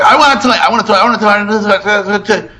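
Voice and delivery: very high mimicked kid nagging on a loop; child's tantrum. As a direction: imitate a relentless tantrum, high-pitched. Retold child's repeated tantrum.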